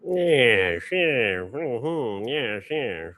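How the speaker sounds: mic volume jumping loud then settling; uneven auto gain. Real, not acted. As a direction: unstable gain, loud bursts. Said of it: "I gotta turn off his auto gain".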